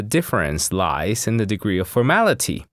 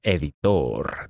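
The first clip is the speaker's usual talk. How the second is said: slower "Editor", leaning on the final "-tor"; stress on last syllable; 3 semitones lower than usual. Shown as a demonstration. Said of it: "The stress is on the last syllable".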